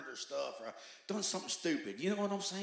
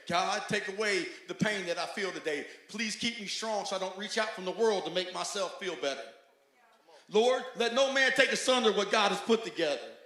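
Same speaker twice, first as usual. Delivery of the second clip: loud, higher pleading prayer that builds; louder and louder pleading to the Lord; fervent prayer; 8 semitones higher, 14 dB louder than usual. Retold or imitated. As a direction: cry out to God from the heart. Quoted prayer in testimony.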